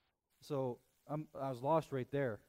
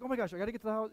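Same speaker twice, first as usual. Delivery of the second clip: stressed rush; 7 semitones higher, much faster than usual. Retold or imitated. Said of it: "I'm stressing".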